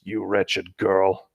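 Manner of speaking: very slow, low sinister voice; ominous, drawn-out menace; sinister message. Acted. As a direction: drag it out like a cursed game line. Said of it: "all that his text said was".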